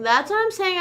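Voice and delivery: emphatic agreement. Real, not acted. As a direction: hit it harder, fully agreeing.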